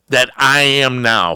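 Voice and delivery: ordinary delivery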